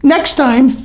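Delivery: very high, slow condescending coo; patronizing baby talk. Pretend. Mocking Josh Fabia as a tiny crying E T, high baby talk. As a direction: talk down to him like a toddler. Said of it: "and you're just like".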